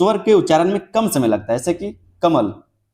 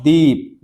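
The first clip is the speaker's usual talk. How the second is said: दीप with a very long EE; stretched vowel; 2 semitones lower, 3 dB louder, much slower than usual. Shown as a demonstration. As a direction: hold the ई in दीप slowly. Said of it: "ई ज्यादा खींचना पड़ा".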